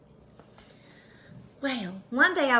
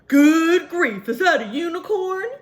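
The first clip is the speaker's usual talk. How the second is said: loud startled cry; a driver gasping in shock; surprised shout; 2 semitones higher, 9 dB louder than usual. A narrator's reading. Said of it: "he shrieked in a great surprise".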